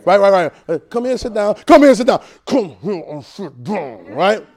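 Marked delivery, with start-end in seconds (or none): 1.7-2.2 higher, louder firm call. Shown as a demonstration. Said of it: "It's different things". 2.5-4.1 drawn out, slow stern order; slow and deliberate. Shown as a demonstration. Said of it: "It's different things".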